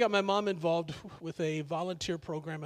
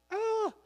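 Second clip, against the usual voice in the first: squeaky yelp. Retold or imitated. Mock shriek after gushing.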